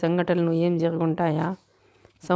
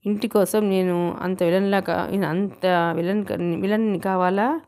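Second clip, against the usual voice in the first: ordinary delivery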